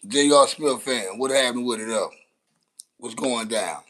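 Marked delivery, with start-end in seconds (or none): none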